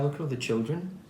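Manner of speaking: ordinary delivery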